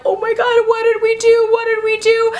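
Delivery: high, loud wail of horror; hammed-up panicked cry; mock panic. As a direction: wail it as if disaster struck. Producers panic at unexpected success.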